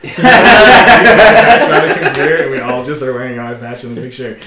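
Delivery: talking through laughter; giggling through the words; laughing. Real, not acted. Laughing while joking.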